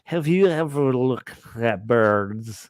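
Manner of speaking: very slow, ponderous film-director voice; droll, drawn-out jowly delivery; Alfred Hitchcock. Acted. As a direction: draw every word out, heavy and deliberate. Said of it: "had a pretty good Alfred Hitchcock".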